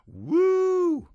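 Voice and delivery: high, loud "woo" cheer; crowd whoop. A narrator's reading. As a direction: let out one long, high whoop.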